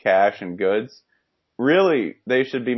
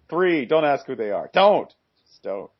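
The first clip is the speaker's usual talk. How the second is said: mock insistence; 6 semitones higher than usual. Podcaster waving off questions about draft picks, mock insistent. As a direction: cut off all questions with high emphatic bursts.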